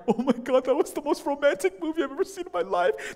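high-pitched mock swooning; tearful gushing friends. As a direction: imitate girls moved by a romance. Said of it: "They're crying".

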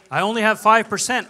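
loud protest. Retold or imitated. Pastor telling Shirley his small down payment, loud and high. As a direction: push it out loud and high, anxious.

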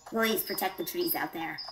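ordinary talk

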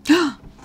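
sudden excited gasp, high; a real gasp of surprise; sharp gasp. Real, not acted. Player spotting the rare bird, gasping.

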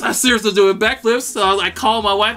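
usual speaking voice

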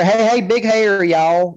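high, drawn-out parody voice; Beth Moore. Retold or imitated. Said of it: "Beth Moore for her".

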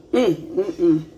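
humming while chewing; mouth full. Real, not acted. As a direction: hum through a mouthful. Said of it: "talking with my mouth full".

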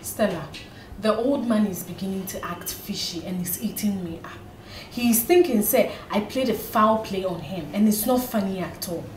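low, hushed confession of trouble; worried confiding. Acted. Confiding in a friend about the old man, worried. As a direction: confide it softly, pitched well down.

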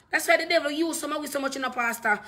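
ordinary delivery

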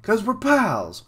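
high, loud, drawn-out buddy voice; Luffy. Acted. Pirate pal explains the rescue, loud and high. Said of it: "it was definitely Luffy then".

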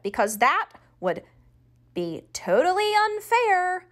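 like a kettle about to boil; rising indignant whine. A narrator's reading. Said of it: "Janie's voice was getting louder and higher".

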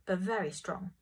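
ordinary delivery

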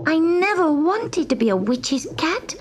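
high, earnest little kitten voice; gentle kitten protesting witches; young kitten. A narrator's reading. Narrator voices Gobelino.

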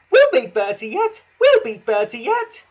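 high, loud squeaky echo; excited high-pitched chorus; echoing carriages. A narrator's reading. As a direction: parrot it back in a high voice. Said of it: "echoed Annie and Clarabel".

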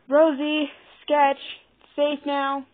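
high, loud call upstairs. A narrator's reading. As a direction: call the little ones down. Calling the others from upstairs.

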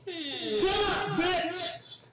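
high angry yell; shouting. Real, not acted. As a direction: yell to cut them off. Shouts to cut off.

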